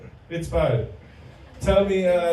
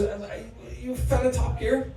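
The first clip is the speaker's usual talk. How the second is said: quoting the dentist; 8 semitones higher, 4 dB quieter than usual. Retold or imitated. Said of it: "He goes".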